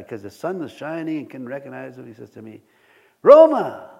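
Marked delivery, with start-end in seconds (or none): 3.2-3.9 much higher, louder shout of a name; calling out. Retold or imitated. Stranger calling the pastor's name across the parking lot, loud. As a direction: hail someone from across the lot.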